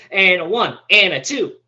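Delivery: loud count-in like starting a band; drummer count-in. Acted.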